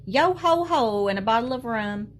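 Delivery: hearty pirate call; pirate shout. A narrator's reading.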